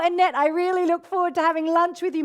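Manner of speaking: ordinary talk, nothing marked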